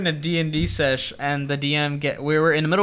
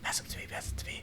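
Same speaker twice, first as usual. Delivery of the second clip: hushed, conspiratorial plea, retold; urgent whisper; 19 dB quieter, faster than usual. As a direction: whisper eagerly behind the caller's back. Secretly asking for the phone.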